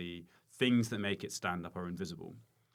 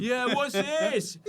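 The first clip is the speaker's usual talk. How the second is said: much higher, louder, slow drop-in voice; mock punter; 11 dB louder, much slower than usual. Comedian mocking tent wanderers, high and loud. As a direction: play a baffled festival-goer stumbling in.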